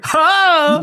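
single high-pitched stray "HA"; one lone guffaw after the crowd stops; imitated late laugh. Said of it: "laughs after the laughing has stopped".

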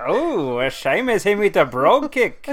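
lilting, hammed-up Irish; mock Irish accent. Co-host joining the Saint Patrick's Day bit, mock Irish accent. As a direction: swoop up and down in stage Irish.

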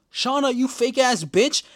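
high, loud mock outrage. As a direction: call her out like a betrayed fan. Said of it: "I'm like".

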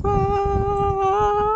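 high delighted fangirl squeal; squealing over a romance scene; excited squeal. Real, not acted. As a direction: let out a giddy high-pitched squeal. Fangirling over the first kiss.

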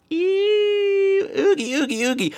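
high drawn-out squeal of disgust; playful grossed-out shiver noises; creeped-out ew. Pretend. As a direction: shudder out a high mock squeal. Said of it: "I just creeped myself out".

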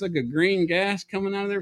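normal talking voice

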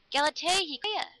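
high, urgent call. A narrator's reading. Lover racing after the girl, calling out. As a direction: call after someone running away.

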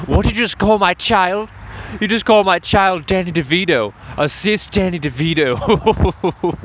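a hammed-up angry parent confronting the name-caller; offended parent. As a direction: play the indignant mother defending her child. Mock parent scolding over nickname.

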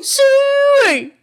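very high, loud hog-calling squeal; shrieking sooey. Acted. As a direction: call the pigs across the holler. Hog call in redneck bit.

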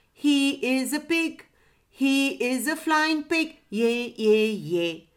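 lightly voiced parrot, high singsong; squawking parrot. A narrator's reading. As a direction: chant it like a mildly squawking parrot. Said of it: "squawked the parrot".

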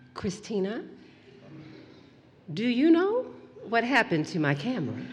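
soft, lightly voiced calm mom; a hint of a quietly scary mother; still-voiced mother. Mother softly questions her daughter about the camera. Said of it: "remembering that still voice".

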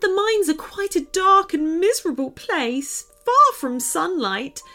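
put-on high, tearful elf girl telling her sad tale; a small frightened elf pouring out her story; upset little elf girl. A narrator's reading.

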